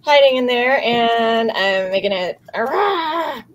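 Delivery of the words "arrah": high yappy creature bark; little puppet bark. Acted. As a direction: bark it like a small furry beast. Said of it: "shout and make little barking noises".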